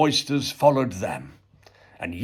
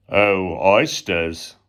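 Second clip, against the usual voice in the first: 1 slow drawn-out address; 3 semitones lower, much slower than usual. A narrator's reading. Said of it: "the carpenter said".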